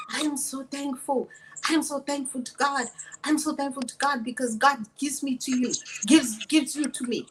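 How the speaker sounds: imitated Omani accent, hard consonants. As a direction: mimic an Omani suitor's thankful English. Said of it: "He's saying all this in his accent from Oman".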